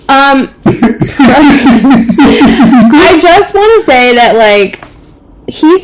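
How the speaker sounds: slow, giggling words; laughing while talking. Real, not acted. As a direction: laugh it out slowly. Laughing through the sentence.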